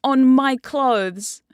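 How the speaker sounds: loud, slow, high commanding queen; bossy queen. Retold or imitated. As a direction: order the sub around, drawn out. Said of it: "and she's like".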